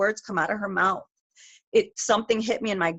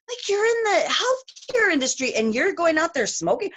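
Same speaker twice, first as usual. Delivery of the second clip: incredulous; 10 semitones higher than usual. Retold or imitated. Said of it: "And I was like".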